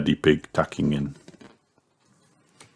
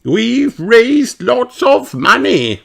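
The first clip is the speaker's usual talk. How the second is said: Madame Gazelle voice; 5 dB louder, slower than usual. A narrator's reading.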